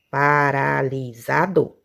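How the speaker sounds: "Paralisado" slowly stretched, pa-ra-li-sa-do; syllable by syllable. Shown as a demonstration. Teacher checking syllable count, slow low "Paralisado" in beats. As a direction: draw out every syllable of "Paralisado". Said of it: "não é duas sílabas".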